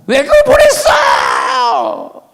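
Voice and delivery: high, loud and drawn out, scolding her slowly; furious shout. Retold or imitated. Preacher voicing an angry scolding of the scammed mother, shouting. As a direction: yell at the mother for sending the money. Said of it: "너무 화딱지나".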